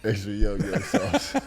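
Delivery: amused echo. Real, not acted. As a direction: savour the silly phrase through laughter. Co-host mockingly repeats the punchline.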